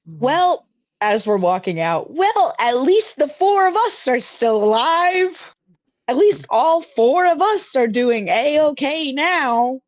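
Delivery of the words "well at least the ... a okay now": high, slow, cheerful character voice; slow, high-pitched hero voice. Acted. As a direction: chirp it out brightly as a naive hero. Player voicing character Ari.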